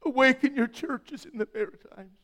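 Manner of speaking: loud, rising preaching prayer; fervent prayer. Acted. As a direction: cry out to God with passion.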